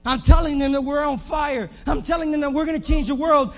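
loud, rising revival preaching; fiery sermon build with a sung lift; preaching at full force. Acted. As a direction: whip the crowd up, ringing out each line. Preacher shouting to the congregation.

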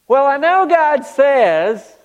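drawn-out doubtful believer; mock hedging believer. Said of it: "I love this statement".

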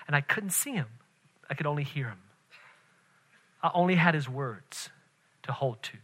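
softened, measured exhortation; low-key, earnest pulpit plea; hushed preacher's appeal. Real, not acted. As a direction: urge them quietly, letting the phrases fall.

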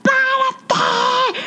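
high, slow furious yell at the dog; angry yelling. Retold or imitated. Owner yelling at her tired three-legged dog, furious high shout. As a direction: scream it down at a stubborn dog. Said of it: "le empiezo a gritar".

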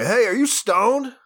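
calling out across a bar. Retold or imitated. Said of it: "You don't wanna be like".

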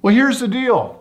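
high, loud, forceful opener; Ted confronting the official, high and loud; Ted laying down terms. Retold or imitated. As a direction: announce the deal loudly with the pitch way up. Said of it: "Ted says to this guy".

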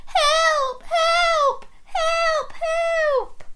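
repeated falsetto help cries; piping, high mock distress; pretend veggie puppet. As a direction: squeal for help as a tiny vegetable. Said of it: "Can you say that with me?".

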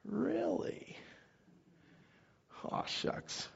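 bashful mock whisper; a whispered, embarrassed aw shucks; whispered awe. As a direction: breathe it out, humbly flattered. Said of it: "you're like".

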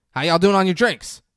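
loud, high-pitched host's call. Retold or imitated. Rolf comes out to check on guests, calling out. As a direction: imitate a hearty shout to the whole table.

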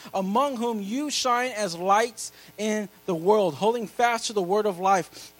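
loud, high preacher's reading of scripture; preaching scripture. Acted. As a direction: proclaim every verse to the whole church. Reads scripture at full preaching force.

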